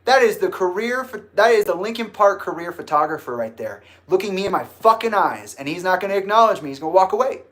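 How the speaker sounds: loud, high indignant tirade; outraged protest. Retold or imitated. Arguing back to G about being ignored, loudly outraged. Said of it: "I'm like".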